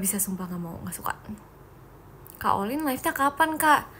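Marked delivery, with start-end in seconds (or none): 0.0-1.2 whispered refusal. Real, not acted. Refusing accounting major.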